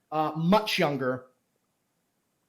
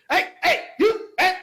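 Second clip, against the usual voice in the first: loud, high-pitched "hey, get out" yell; angry shooing shout; 11 semitones higher, 5 dB louder than usual. Acted. Game master as someone behind the players ordering a person out, shouting. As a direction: shout them out, fast and high. Bystander angrily chasing someone off.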